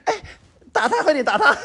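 high, gleeful cheering; egging them on. Retold or imitated. Girl gleefully cheering on the fight. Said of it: "我就说".

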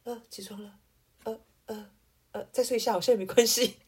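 lazily voiced sleepy person; limp, lazy call. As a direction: mumble as if still half asleep. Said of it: "软软的人".

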